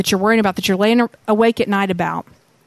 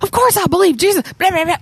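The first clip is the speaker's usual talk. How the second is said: high, fast brush-off; dismissive; 6 semitones higher, faster than usual. Pretend. Imagined proud dad brushing Jesus off, quick and dismissive. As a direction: rattle it off, proud and careless. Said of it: "what if the dad had said".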